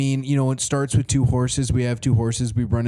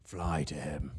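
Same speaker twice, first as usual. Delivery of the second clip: much lower and softer, breathy order to the bird; hushed command to falcon; 11 semitones lower, 13 dB quieter than usual. Acted. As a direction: send the falcon off in a low hush. I have a falcon.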